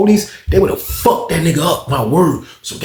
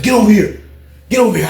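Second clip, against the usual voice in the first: mock barked order; 7 semitones higher, 4 dB louder, faster than usual. Manager orders inmate.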